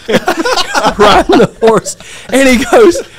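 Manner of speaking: words breaking into high laughter; laughing. Real, not acted. Telling friends about riding the horse, laughing. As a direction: laugh helplessly through the telling.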